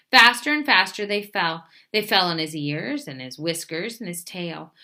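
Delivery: usual speaking voice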